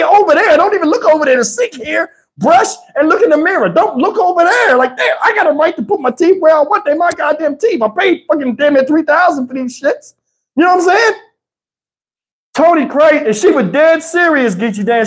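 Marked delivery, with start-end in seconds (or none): none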